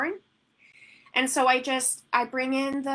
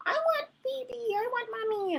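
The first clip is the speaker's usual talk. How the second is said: put-on toddler voice. Imitating toddler wanting in.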